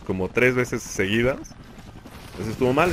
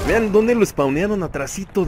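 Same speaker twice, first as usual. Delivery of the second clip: outraged disbelief; 7 semitones higher, 3 dB louder than usual. Real, not acted. Enemy spawned right behind him.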